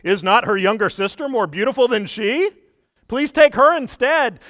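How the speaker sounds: high, loud, slowing plea of a scared father; terrified pleading. Retold or imitated. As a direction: beg Samson, voice climbing high. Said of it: "he says this most incredible thing".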